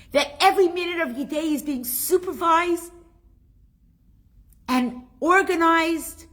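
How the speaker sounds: high, loud rush of real excitement; thrilled, getting chills. Real, not acted. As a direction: let the goosebumps lift the voice. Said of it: "I'm getting the chills now".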